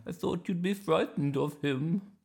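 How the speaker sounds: high, wobbly-pitched grandma voice. Acted. Grandma to Iris about the bear, high and surprised. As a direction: say it as a surprised old granny. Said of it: "said Grandma".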